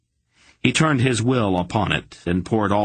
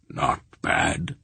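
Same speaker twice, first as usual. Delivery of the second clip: low, gruff grandfather drawl, much lower; old man voice; 6 semitones lower than usual. A narrator's reading. Grandfather Belgarath judging Garion's work, low old voice. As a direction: sound like a weary old sorcerer.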